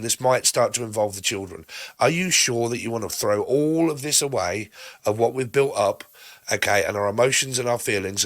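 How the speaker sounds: ordinary talk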